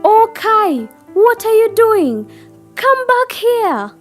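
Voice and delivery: put-on high, loud old-lady call; high-pitched urgent calling; calling out in alarm. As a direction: shout after the runaway child. Old lady calls after Kai.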